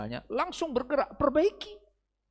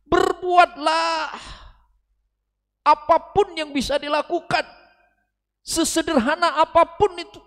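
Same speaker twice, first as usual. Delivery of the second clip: slow, louder, higher call to act; preaching shout; 11 dB louder, slower than usual. Real, not acted. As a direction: drive each word home to the crowd. Seruan lantang kepada jamaah. (berseru keras dan lambat kepada jamaah)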